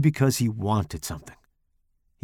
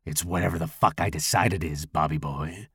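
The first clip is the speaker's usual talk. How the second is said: low, gravelly menace; rough, gravelly voice; 7 semitones lower, 4 dB quieter than usual. A narrator's reading. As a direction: put him in his place with a low rasp. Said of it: "with a rough, gravelly voice".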